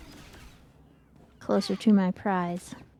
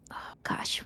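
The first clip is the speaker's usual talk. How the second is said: whispered oh gosh; 10 dB quieter than usual. Real, not acted. Muttered aside while searching menu.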